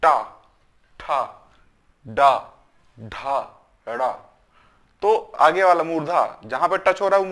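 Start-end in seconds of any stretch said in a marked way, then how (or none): 0.0-4.1 टा, ठा, डा, ढा, ड़ा with tongue on hard front palate; spaced-out demo of टा, ठा, डा, ढा, ड़ा, each a hard retroflex; retroflex consonant drill. Shown as a demonstration. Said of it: "में जीभ टच करती है तब इसका उच्चारण होता है".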